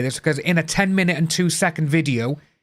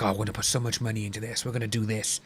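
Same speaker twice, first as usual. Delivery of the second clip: greedy creator; 7 semitones lower, 9 dB quieter, much faster than usual. Retold or imitated.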